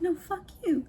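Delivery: high mock-combative retort; ready to fight. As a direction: imitate a boss spoiling for a fight, pitched high. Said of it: "he's ready to throw rounds".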